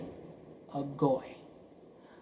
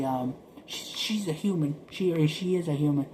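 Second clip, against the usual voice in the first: ordinary talk, nothing marked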